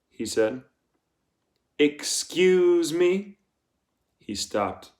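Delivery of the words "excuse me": slow questioning warden. A narrator's reading. Warden challenges Stanley's answer.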